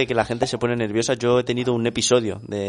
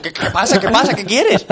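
mock confusion; 9 semitones higher, 4 dB louder than usual. Confused retort to the clerk.

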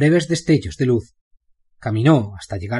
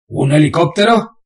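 incredulous question; 5 semitones higher, 5 dB louder than usual. Acted. Bane surprised.

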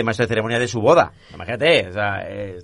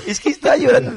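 laughing; 13 semitones higher, 6 dB louder than usual. Real, not acted. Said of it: "llorar de la risa".

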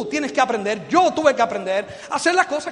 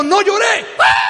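exasperated shout; 7 semitones higher, 6 dB louder, slower than usual. Retold or imitated. Parent yelling at crying toddler.

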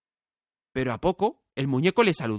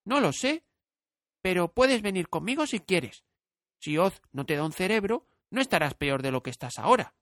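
put-on higher, gentle child inviting; kind girl; 7 semitones higher than usual. A narrator's reading. Dorothy invites the scarecrow along. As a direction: offer cheerfully.

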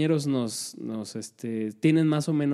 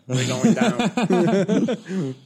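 pilot cockpit headset; 2 semitones higher, much slower than usual. Pretend. Pilot distress call acted.

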